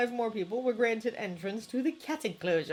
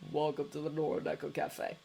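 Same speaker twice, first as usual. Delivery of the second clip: low, soft and level; 5 semitones lower, 5 dB quieter than usual. A narrator's reading. Cafe owner greeting tourists, low and soft. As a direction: keep it low and quiet, a tired cafe owner.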